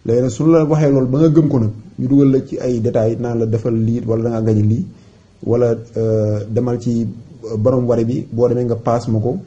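normal talking voice

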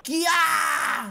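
sharp shouted shriek; acted scream. Someone screaming outside in the storm, shrieking. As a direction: shriek it out in mock alarm. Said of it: "Someone just screamed".

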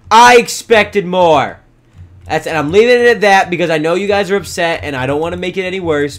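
loud, exasperated parental lecture; scolding. Pretend. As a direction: raise your voice at naughty pets.

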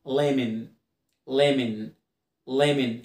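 ليمون said "lemen", no o sound; low, measured ليمون with the o swallowed; silent O. Shown as a demonstration. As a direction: say ليمون as two short syllables, lem-en. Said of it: "الاو هنا سايلنت".

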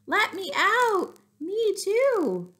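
high, loud little voices crying to be let out; muffled cries for help. Acted. Trapped girl and grandma call from the wolf's belly, high and loud. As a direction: squeal it out from inside the belly.

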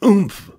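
acted pig grunt on landing; high grunt. A narrator's reading. Pig lands with a thump, high grunt. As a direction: let out a high-pitched "oomph". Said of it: "he grunted".

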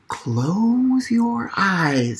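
slow, hushed and calm; soft slow soothing. Acted. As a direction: lull them to close their eyes. Frederick soothes the mice.

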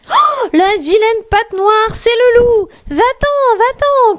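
put-on very high cries of frightened little kids; high-pitched alarmed children's outcry; crying out. A narrator's reading. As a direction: shriek it in high little voices, chasing the wolf off. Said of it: "crient les biquets".

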